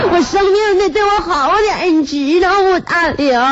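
high-pitched, whiny mock blubbering; crying while talking. Sick wife demanding care from husband, mock tearful whine. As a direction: sob out a hammed-up demand. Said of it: "我一边哭一边说".

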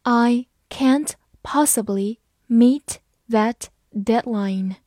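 slow, deliberate read. Shown as a demonstration. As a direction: say it slowly for learners. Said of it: "我们慢读一遍". (慢速朗读)

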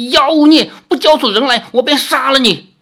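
high, loud shout through clenched teeth; furious threat. A narrator's reading. Li Xiaoyao threatens the fox demon, furious shout. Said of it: "咬牙切齿地说".

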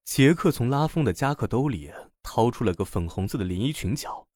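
ordinary delivery, nothing marked

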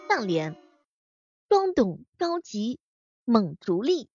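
slow, declaiming the couplet; drawn out like a recital. Acted.

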